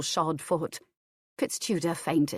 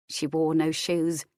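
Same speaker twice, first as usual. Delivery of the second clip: ordinary delivery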